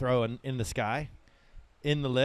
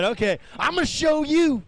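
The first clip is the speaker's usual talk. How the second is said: high, loud boast; mock tough surfer; 10 semitones higher, 6 dB louder than usual. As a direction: throw down the challenge loudly. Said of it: "He said".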